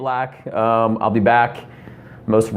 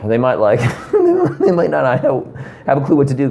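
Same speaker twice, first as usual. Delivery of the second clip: laughing; 4 semitones higher than usual. Real, not acted. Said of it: "laughs on these lines".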